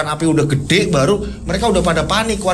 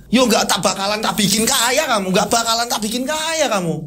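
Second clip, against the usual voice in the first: higher, worked-up shaman; indignant refusal; 6 semitones higher than usual. Acted. Shaman refusing to make the couple rich, indignant. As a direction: refuse them in rising indignation.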